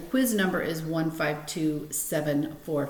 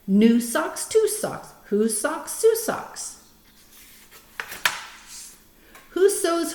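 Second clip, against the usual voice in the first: put-on reading to children; 3 semitones higher, 4 dB louder than usual. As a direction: read each rhyme out carefully for the kids. Reads Fox in Socks aloud.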